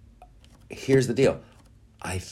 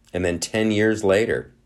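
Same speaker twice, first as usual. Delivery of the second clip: ordinary delivery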